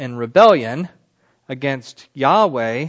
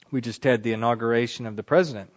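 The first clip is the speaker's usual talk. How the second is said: ordinary delivery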